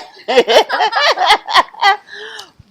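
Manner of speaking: high, loud burst of giggling; laugh. Real, not acted. Amused while announcing the title.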